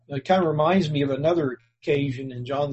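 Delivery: usual speaking voice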